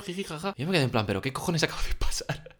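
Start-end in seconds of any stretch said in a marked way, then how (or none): 0.0-0.5 mock giggle. Old men laughing at him. 1.1-2.6 whispered disbelief; a hushed what-the-hell; stunned whisper. Retold or imitated. Stunned inner reaction.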